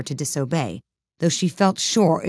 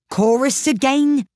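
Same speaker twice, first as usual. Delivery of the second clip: higher cry for the chorus; raised call; 9 semitones higher, 4 dB louder than usual. Acted. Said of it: "cried the Gryphon".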